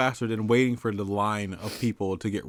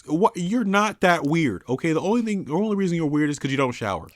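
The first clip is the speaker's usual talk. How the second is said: loud rant; 6 semitones higher, 5 dB louder than usual. Real, not acted. Ranting about wannabe-weird people.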